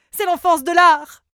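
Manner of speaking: loud, proud boast; boastful shout. Acted. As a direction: trumpet it out proudly. Vain singer boasting loudly.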